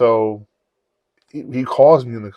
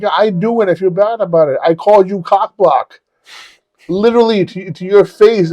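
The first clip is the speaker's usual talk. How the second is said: loud, high retold admission; emphatic confession; 9 semitones higher, 6 dB louder than usual. Man confessing an insult to his friend, emphatic. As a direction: own up at the top of the voice.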